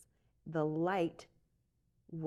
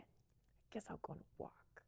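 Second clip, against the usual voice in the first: whisper; 18 dB quieter than usual. Retold or imitated. Sneaking out past sleeping roommate.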